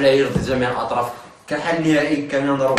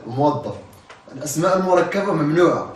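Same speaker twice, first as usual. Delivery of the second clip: usual speaking voice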